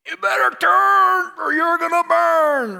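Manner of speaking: mock hellfire shout, high and drawn out; yelling preacher. Preacher imitating those who yelled at churchgoers since childhood, yelling. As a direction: bellow it slowly, stretching turn and burn. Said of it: "somebody's yelled at me".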